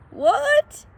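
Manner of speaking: high, small timid dog voice; a high, timid sidekick pipe; Drover's voice. A narrator's reading. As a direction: squeak it out like a nervous little dog. Drover startled.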